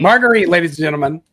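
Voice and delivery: loud, quick showman's introduction; mock emcee. Author hails a panelist to the audience, mock emcee. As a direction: present them to the crowd like an emcee.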